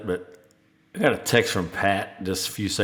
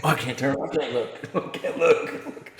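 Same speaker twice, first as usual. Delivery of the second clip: mock horrified; 4 semitones higher, 4 dB quieter than usual. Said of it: "It's like one of those".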